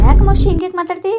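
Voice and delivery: loud, high startled question; shocked alarm. Acted. Niece startled by her aunt's bitter words, loud shocked question.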